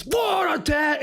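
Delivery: high, hammed-up angry shout; mock an angry reviewer yelling his score; Angry Joe. Co-host imitating the reviewer's outburst, mock shouting. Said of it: "Because Angry Joe just goes".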